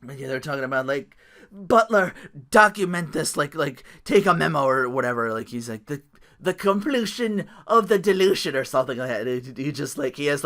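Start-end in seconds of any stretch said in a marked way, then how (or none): 1.5-4.7 higher, louder, grandiose command; Broken Matt Hardy impression. Mimicking Matt Hardy ordering his butler, grand command voice. 5.8-8.5 higher, louder, theatrical announcement; grandiose wrestler voice. Retold or imitated. Mimicking Matt Hardy announcing the dilution, pompous proclamation.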